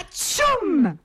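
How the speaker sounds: high hammed-up sneeze; a big sudden sneeze.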